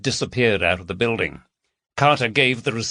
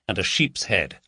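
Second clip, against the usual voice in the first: ordinary delivery, nothing marked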